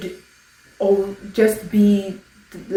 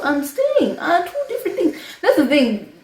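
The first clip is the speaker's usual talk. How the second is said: exasperated laugh; 7 semitones higher than usual. Real, not acted. Laughs while making her point.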